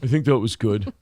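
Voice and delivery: lightly mocked lack of confidence; unsure imitation. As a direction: imitate someone doubting their own work. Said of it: "he didn't have a lot of confidence".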